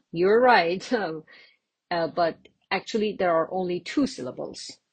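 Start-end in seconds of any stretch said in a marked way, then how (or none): none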